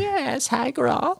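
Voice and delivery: very high, crooning witch voice; cackling old hag. Acted. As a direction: sweet-talk the cat like a creepy old hag. Hag answers the visitor.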